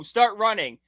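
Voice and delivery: usual speaking voice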